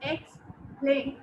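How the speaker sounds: "Explain" as EX, pause, PLAIN; divided in syllables. Shown as a demonstration. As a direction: sound out both halves of "Explain" separately. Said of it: "this word is divided in two syllables".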